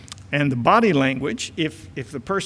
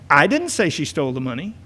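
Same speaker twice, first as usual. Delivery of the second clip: "I" stressed to point at someone else; faster than usual. Shown as a demonstration. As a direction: punch the first word "I", then lift the end of the sentence. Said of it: "just by the inflection of your voice".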